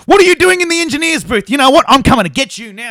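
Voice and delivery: very high, loud angry yelling; furious threat. Acted. Narrator to the intruder in the engineer's booth, furious. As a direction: yell as if about to charge at him.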